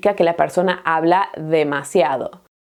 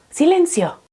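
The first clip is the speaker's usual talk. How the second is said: silencio snapped much higher; sharp silencio; 10 semitones higher than usual. Acted. Teacher losing patience with the class, high sharp call.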